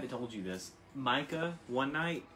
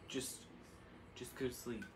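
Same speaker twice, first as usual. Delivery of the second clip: much softer, half-asleep; sleepy murmur; 12 dB quieter than usual. Retold or imitated. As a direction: imitate a drowsy partner brushing off questions. Half-asleep partner murmuring, retold.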